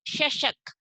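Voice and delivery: "चषक" said as shashak, with sh sounds; tongue to palate for the sh in "चषक"; palatal श. Shown as a demonstration. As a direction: model word "चषक", pronounced shashak. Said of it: "जीभ को कहाँ touch करना है".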